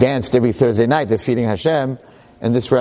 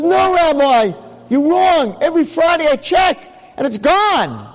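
stubborn excited objection; insistent protest. Acted. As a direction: insist the rabbi is wrong. Man insists against the rabbi.

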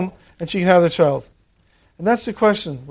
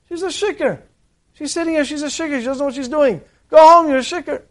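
acted indignation; 8 semitones higher than usual. Eli decides the praying woman is drunk, indignant.

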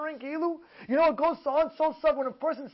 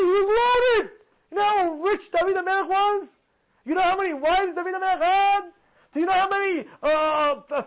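high, driving sermon delivery; fervent, raised preacher's voice; impassioned preaching; 6 semitones higher than usual. Acted. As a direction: preach it insistently, lifting each rhetorical question. Preaches at full force.

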